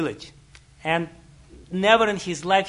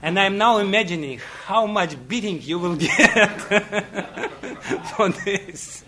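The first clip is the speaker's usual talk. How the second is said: higher, louder, breaking into laughter mid-sentence; laughing; 3 semitones higher, 5 dB louder than usual. Retold or imitated. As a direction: tell the punchline through helpless giggles. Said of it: "She started laughing".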